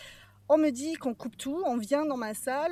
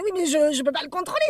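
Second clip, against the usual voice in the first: retold panic; 5 semitones higher, 8 dB louder than usual. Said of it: "je panique, je panique".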